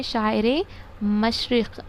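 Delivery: शायर मशरक़ said very slowly; slow for memorizing. Shown as a demonstration. Teacher makes students memorize शायर मशरक़, slowly. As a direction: drag out शायर मशरक़ so it sticks. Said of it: "याद रखिए".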